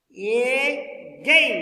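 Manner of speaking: "Again" as a long, loud a, then gain; syllable by syllable. Shown as a demonstration. Teacher counting syllables for students, "Again" split in two. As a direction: stretch "Again" into two separate strong beats. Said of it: "Two syllable".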